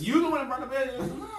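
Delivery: playful accusing. Real, not acted. Playful accusation, fast, high.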